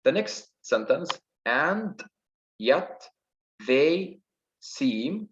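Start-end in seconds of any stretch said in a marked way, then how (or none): 1.5-5.3 isolated beats with pauses between; syllable by syllable. Shown as a demonstration. Teacher counting six syllables for students, syllable by syllable. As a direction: count out each syllable separately. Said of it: "Here is six syllables".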